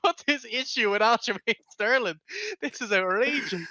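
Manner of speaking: loud, high outrage cracking into laughter; incredulous outburst. Retold or imitated. Reacting to the coach wanting blood, incredulous laughing shout. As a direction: exclaim in appalled amusement.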